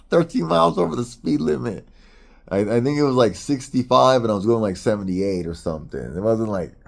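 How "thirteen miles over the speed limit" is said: higher, laughing at how minor it was; incredulous chuckle through the line; laughing disbelief. Shown as a demonstration.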